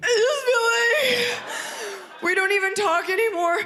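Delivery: very high-pitched sobbing whine; hammed-up weepy girlfriend; mock crying. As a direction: wail it through fake tears. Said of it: "And I would start crying".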